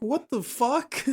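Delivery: high, slow squeal of disbelief; stunned disbelief. Retold or imitated. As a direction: re-enact being stunned, pitch shooting up, drawn out. Retells own stunned reaction.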